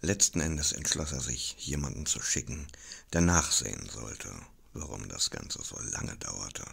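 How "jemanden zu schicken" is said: ordinary delivery, nothing marked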